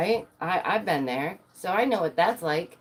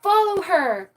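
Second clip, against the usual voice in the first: excited fans; 13 semitones higher, 4 dB louder than usual. Retold or imitated. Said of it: "And they go".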